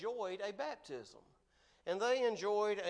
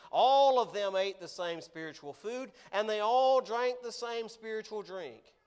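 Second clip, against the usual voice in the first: every "all" read out loud and heavy; stress on all; 6 dB louder than usual. Shown as a demonstration. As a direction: lean hard on each "all" as you read. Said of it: "I emphasize the words all".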